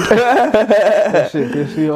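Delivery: laughing through the words. Real, not acted. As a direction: speak while cracking up. Laughing at friend's school bully.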